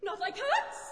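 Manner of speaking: loud, high indignant cry; affronted little mouse; shrill, passionate. A narrator's reading. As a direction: squeal it in outrage, rising at the end. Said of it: "in a shrill, passionate voice".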